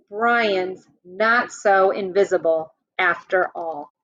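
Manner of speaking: ordinary talk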